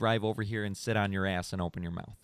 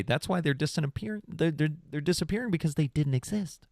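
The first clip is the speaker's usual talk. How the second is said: usual speaking voice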